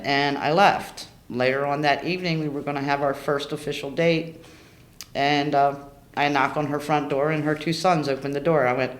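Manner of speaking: ordinary talk, nothing marked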